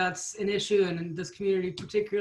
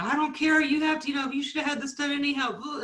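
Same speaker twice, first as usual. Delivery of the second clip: raised, higher-pitched scolding, retold; mock mom screaming; 7 semitones higher than usual. Mom to child during online class, mock screaming. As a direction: imitate a mom yelling over her child. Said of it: "and just scream at 'em".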